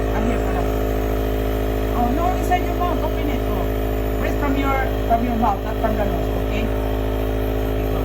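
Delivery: loud, calling over the nebulizer's hum. Real, not acted. Parent calling to sick child over nebulizer, loud.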